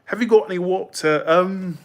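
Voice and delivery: lightly put-on British voice; a chatter asks for British, tries it; British accent. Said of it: "Do you, a Bra-, British accent?".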